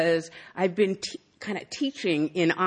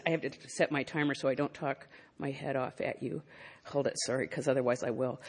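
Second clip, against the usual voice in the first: soft, low aside while busy; quieter, half to herself; muttered aside; 5 semitones lower, 10 dB quieter than usual. Real, not acted. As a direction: drop the voice for housekeeping. Aside while setting her timer.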